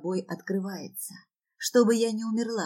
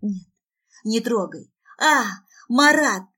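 frantic acted squeal; shrieking; 3 semitones higher, 7 dB louder than usual. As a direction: fight them off, then scream the name. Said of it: "визжу".

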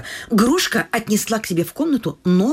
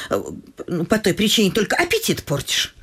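normal talking voice

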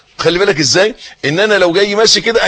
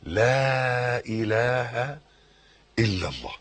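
"لا إله إلا الله" said very slowly and much softer, each word drawn out; sincere, from the heart; 5 semitones lower, 12 dB quieter, much slower than usual. Shown as a demonstration. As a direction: let "لا إله إلا الله" come quietly from the heart, stretching every syllable. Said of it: "إن أنا أقول بقى". ("لا إله إلا الله" ببطء شديد وصوت خافت من القلب)

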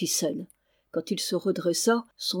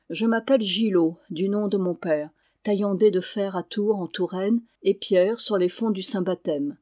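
usual speaking voice

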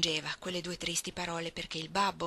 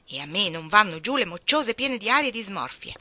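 retort; 3 semitones higher, 9 dB louder than usual. A narrator's reading. Jo firing back in quarrel.

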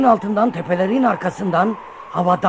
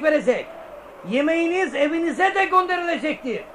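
town crier; 8 semitones higher than usual. Acted. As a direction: proclaim it to the square. Herald announcing to the people.

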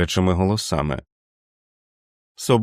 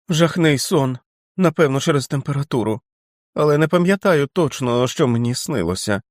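abrupt; 6 semitones higher than usual. A narrator's reading. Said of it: "різко додав він".

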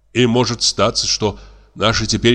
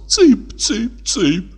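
very high, thin piping call; high thin teasing chick call; 9 semitones higher, much slower than usual. Acted. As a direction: pipe it out mockingly like calling chicks. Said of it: "Солёный тонким голосом".

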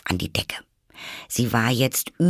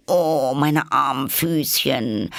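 slow, higher lament; mock pity; 5 semitones higher, 3 dB louder, slower than usual. A narrator's reading. Alice pitying her distant feet, slow mock lament. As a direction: pity your poor little feet slowly.

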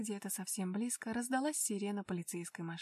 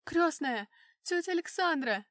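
loud call into the dark; calling out loudly; 6 semitones higher, 8 dB louder than usual. Acted. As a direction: shout the name across the field. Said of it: "Громко позвала я". (громкий зов)

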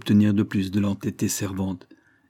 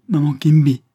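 high, loud wail; moaning; 6 semitones higher, 9 dB louder than usual. A narrator's reading. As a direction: wail the name in appeal. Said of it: "gémit-il".

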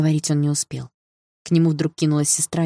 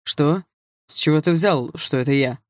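defensive, indignant burst; startled protest; mild outcry. A narrator's reading. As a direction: call out the denial, only slightly raised. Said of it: "выкрикнул Руслан".

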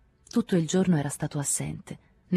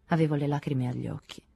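ordinary delivery

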